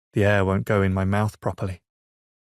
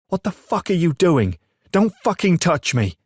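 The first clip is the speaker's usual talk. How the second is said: much higher and louder, a shocked outburst; furious shout; 9 semitones higher, 5 dB louder than usual. A narrator's reading. Woken brother screams at Ezra for ripping the duvet, furious shout. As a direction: yell like he's been ambushed. Startled man yells at brother.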